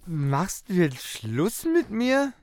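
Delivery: ordinary delivery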